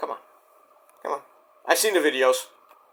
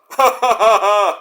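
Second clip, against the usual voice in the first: laugh; 4 semitones higher, 4 dB louder than usual. Real, not acted. Laughs at own joke.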